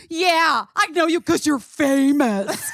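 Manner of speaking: high, slow, sing-song mockery; mocking. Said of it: "Like, mocking me".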